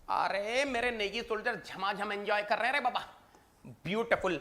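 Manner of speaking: usual speaking voice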